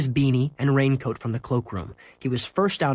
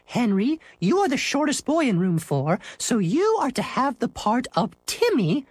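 teacher announcing; 12 semitones higher than usual. Acted. Teacher giving Henry his part before the class, high-pitched announcement. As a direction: announce the prize role in a raised, sing-out voice.

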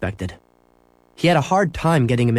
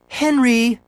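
high-pitched call, mildly raised; shouting mother; 12 semitones higher than usual. A narrator's reading. Mother calling to son over the phone, mock shout. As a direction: call out high in alarm without full volume. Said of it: "Missus Huggins shouted".

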